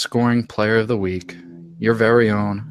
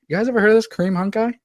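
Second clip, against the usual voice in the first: fast and much higher, bursting with hype; excited; 13 semitones higher, much faster than usual. Real, not acted. Host hyping his star player to co-hosts, fast and excited. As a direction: rattle it off, giddy.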